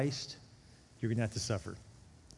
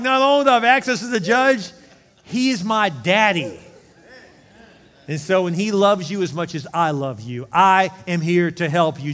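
Jesus as advocate; 3 semitones higher, 10 dB louder than usual. Pretend.

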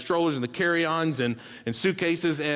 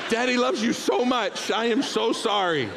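remorseful apology; 7 semitones higher than usual. Retold or imitated. Father apologising to daughter.

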